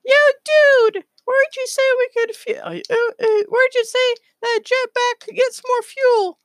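very high, squeaky squirrel voice; Space Squirrel. Acted. Space Squirrel asks his friend about jetpack fuel, very high squirrel voice. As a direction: pipe up in extreme falsetto. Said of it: "looks over to, uh, Bran and is like".

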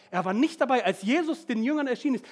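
normal talking voice

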